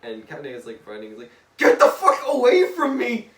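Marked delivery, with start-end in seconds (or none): 1.6-3.3 very high, loud acted scream; panicked yell. Captain Ahab running from Deadpool, yelling in panic. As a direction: yell it as if fleeing for your life.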